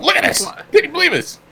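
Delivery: very high, indignant squawk; mock yelling. Bus ranter to an unwilling stranger, mock outraged yelling. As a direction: pester a stranger with shrieked disbelief. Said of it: "Why are we yelling?".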